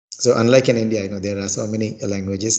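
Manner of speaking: usual speaking voice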